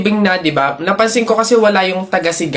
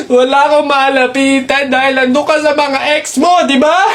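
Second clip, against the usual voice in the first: tearful shouted confrontation; 5 semitones higher than usual. Acted. Acted quarrel, other partner.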